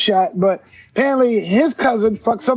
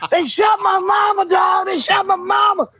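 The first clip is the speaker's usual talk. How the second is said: high-pitched wailing imitation; mock outcry, pitched way up and repeated; distraught roommate; 9 semitones higher than usual. Shot roommate phoning everybody about his mama, high outraged wail.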